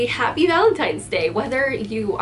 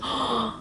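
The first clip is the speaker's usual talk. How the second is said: soft gasp; 6 dB quieter than usual. Real, not acted. Sudden thought of Shang.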